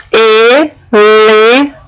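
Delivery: "Elefante" drawn out slowly, each syllable apart; sounding out syllables. Shown as a demonstration. Teacher sounds out "Elefante" slowly for the class. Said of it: "pra ela, tem esse som de H".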